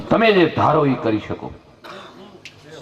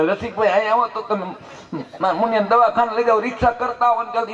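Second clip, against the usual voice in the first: higher, hurried plea to a neighbour; urgent call for help; 8 semitones higher than usual. Retold or imitated. Mother begs neighbour to take baby to hospital, urgent higher plea. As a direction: call Rasikbhai over fast, worried.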